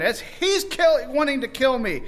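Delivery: high, loud desperate accusing; urgent accusation. Retold or imitated. Esther denouncing Haman before the king, high urgent pleading. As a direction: point him out and beg.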